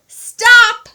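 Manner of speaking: very loud high shout; boy shouting after the runaway dog. A narrator's reading. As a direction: yell to stop the dog. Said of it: "Edward shouted".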